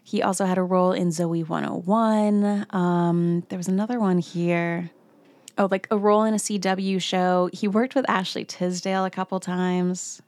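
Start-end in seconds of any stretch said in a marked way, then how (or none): none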